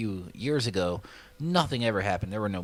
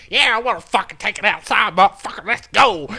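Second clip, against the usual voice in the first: loud high hammed-up shout accepting the fight; mock yell; 10 semitones higher, 11 dB louder than usual. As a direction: bellow it, ready to brawl. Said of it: "the other guy's like".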